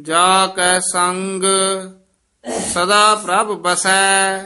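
measured, half-chanted verse reading; chanted Gurbani reading; scripture recitation. Acted. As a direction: recite sacred verse with long held vowels.

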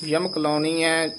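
normal talking voice

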